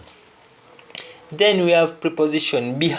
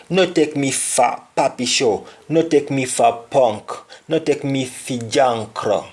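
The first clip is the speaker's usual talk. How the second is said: "No take me for idiot" in a Jamaican Patois lilt, "for" as "fa"; a touch of Patois indignation. Shown as a demonstration. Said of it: "an example like".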